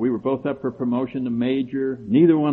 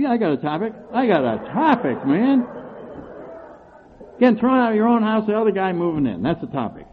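higher, louder defiant insistence; defiant demand; 8 semitones higher, 3 dB louder than usual. Retold or imitated. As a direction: push your topic hard. Retold demanding topic at meeting.

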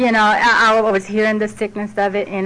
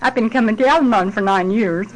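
usual speaking voice

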